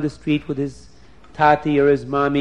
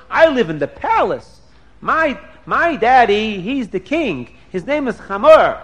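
higher, louder bragging; childish boasting; 6 semitones higher, 5 dB louder than usual. Acted. Prince brags to Dina about the palace, childish boasting. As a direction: show off about daddy.

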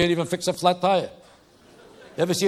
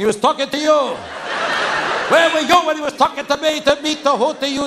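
bickering couple; 8 semitones higher than usual. Acted. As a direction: yell it like spouses blaming each other. Husband and wife quarrel over directions.

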